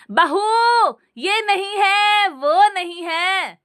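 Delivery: high, slow shouting complaint. A narrator's reading. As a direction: yell at the daughter-in-law about what is missing. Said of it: "सास चिल्लाती और कहती".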